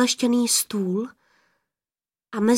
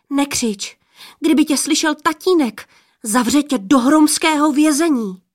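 put-on higher, urgent girlish warning; anxious scolding in a raised voice; 5 semitones higher, 4 dB louder than usual. A narrator's reading. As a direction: caution him about father's punishment. Girl warns the intruder.

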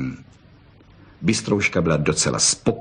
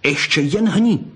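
higher, threatening fox cry; yelping fox; 6 semitones higher than usual. A narrator's reading. Fox warns the boy not to move, yelping. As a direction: dare the boy in a raised pitch. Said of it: "vyjekla Bystrouška liščí řečí".

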